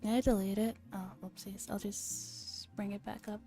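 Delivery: ordinary delivery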